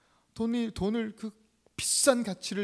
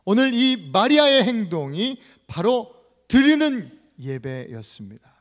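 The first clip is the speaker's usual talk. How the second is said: loud, slow, emphatic preaching; forceful proclamation; 4 semitones higher, 13 dB louder, slower than usual. Acted. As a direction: proclaim it to the whole congregation. Preacher proclaims his main point. (힘주어 선포하는 설교)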